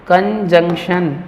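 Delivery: slow, broken-up कंजंक्शन, "kan-jung-shan"; correct pronunciation. Shown as a demonstration. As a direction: stretch out कंजंक्शन with its middle N. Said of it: "एन का sound आएगा यहाँ पर". (कंजंक्शन धीरे, न की आवाज़ के साथ)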